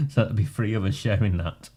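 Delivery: normal talking voice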